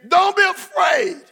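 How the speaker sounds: don't be afraid, belted high and slow; preacher booming comfort to the expectant; shouted reassurance. Shown as a demonstration. Preacher shouting don't be afraid to the congregation. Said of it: "Say it again".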